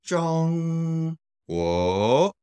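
long flat high ZHONG, rising GUO; tone demonstration. Shown as a demonstration. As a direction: sustain a high ZHONG, then let GUO climb. Teacher repeats for practice.

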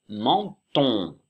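menton étiré, chaque syllabe nette; très lent, dicté. Shown as a demonstration. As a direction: dictez menton très lentement. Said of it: "Et le en de menton". (menton lentement articulé pour les élèves)

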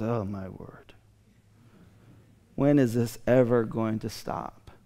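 hushed, worn-out sigh of a line; weary Jesus aside. Retold or imitated. Jesus muttering to disciples, hushed and worn out. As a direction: say it quietly like he's had enough. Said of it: "looks at his disciples and says".

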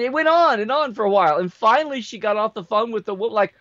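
ordinary talk

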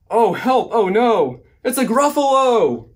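high, loud storybook panic; theatrical shriek of alarm from the mouse; alarmed cry. A narrator's reading. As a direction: cry out in hammed-up fright, stretching the last word. Mouse alarmed at the real gruffalo.

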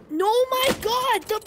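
loud, high, drawn-out yell; frustrated outburst. Real, not acted. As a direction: cry out at a botched shot. Frustrated by missed shots.

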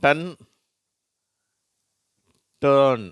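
டன் short, டர்ன் loud and drawn out; short then long vowel. Shown as a demonstration. Teacher reading the next row, vowel-length contrast. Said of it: "என்ன உச்சரிப்புகளை பார்க்குறோம்".

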